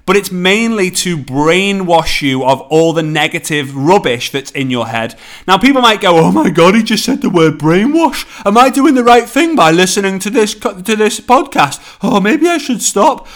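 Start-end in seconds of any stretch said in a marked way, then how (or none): 6.2-13.3 higher-pitched, hammed-up alarm; mock worried listener. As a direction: play a doubting listener in a mild panic. Voices a worried podcast listener.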